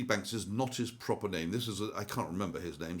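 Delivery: normal talking voice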